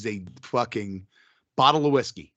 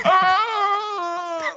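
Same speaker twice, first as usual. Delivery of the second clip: laughing shriek; 3 dB louder than usual. Real, not acted. Loud laughing cry.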